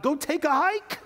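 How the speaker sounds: high-pitched mock dismissive yell; defiant outburst. People telling God off, high defiant shout. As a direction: fling it out high and strained.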